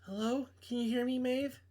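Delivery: a hint of a young woman on the phone; girl voice. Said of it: "I can't do girl voices".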